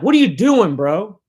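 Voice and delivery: put-on a kid caught off guard, yelling; startled yell. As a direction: call out at your brother, half shouting. Catching brother with his knife.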